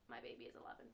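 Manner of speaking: unvoiced fond whisper. Real, not acted. Fan whispering her favourite Doctor to viewers. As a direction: breathe it like a secret.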